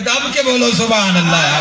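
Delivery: rally the crowd to shout; urging shout. Real, not acted. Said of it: "آواز ہی نہ نکلے".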